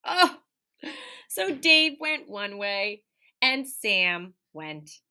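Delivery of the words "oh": usual speaking voice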